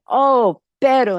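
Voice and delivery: exasperated groan. Acted. Cowboys realize they were tricked.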